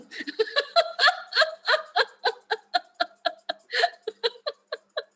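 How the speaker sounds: a long high laugh close to tears; long laughter. Real, not acted. Amused by her daughter calling herself manipulative, laughing close to tears. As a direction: laugh until it nearly turns to crying.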